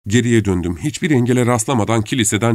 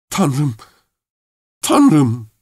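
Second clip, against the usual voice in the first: higher-pitched stunned "Tanrım"; murmured exclamation; 5 semitones higher than usual. A narrator's reading. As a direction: exclaim in disbelief, with a pause between. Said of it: "diye mırıldandı". (şaşkınlıkla, tiz sesle)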